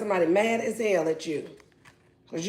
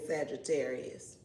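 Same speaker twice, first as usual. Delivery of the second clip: usual speaking voice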